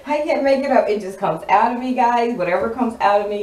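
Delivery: excited outburst. Real, not acted. Excited aside to viewers.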